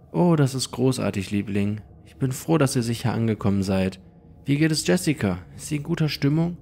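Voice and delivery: höhere, fröhlich besorgte Mutterstimme; Mutter am Telefon. Acted. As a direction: eine liebevolle Mama, etwas höher gesprochen. Mutter am Telefon, Erzähler spricht sie. (höhere, liebevolle Mutterstimme am Telefon)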